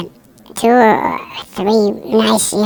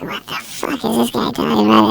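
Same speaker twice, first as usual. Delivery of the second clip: inner thought; 7 dB quieter than usual. Retold or imitated. Puzzled student thinking during class criticism, hushed inner voice. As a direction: say it softly, as if thinking to yourself. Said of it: "in my head, I'm like".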